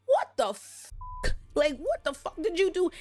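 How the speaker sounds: slow, high, disbelieving what the fuck; outraged demand. Retold or imitated. Otto bursting into the room at his grandson, slow and outraged. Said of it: "Otto literally busts through the room like".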